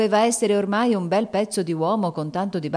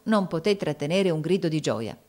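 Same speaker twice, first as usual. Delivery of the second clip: usual speaking voice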